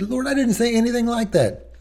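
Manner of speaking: high, indignant protest; protesting innocence. Retold or imitated. Pastor protesting to the Lord, high and indignant. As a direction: insist you never said that. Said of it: "I said".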